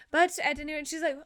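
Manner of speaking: ordinary talk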